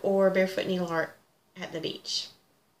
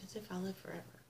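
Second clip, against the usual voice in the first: whispered joke; 16 dB quieter, faster than usual. Real, not acted. Joking to viewers about her stitching pile, whispered. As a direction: whisper the punchline.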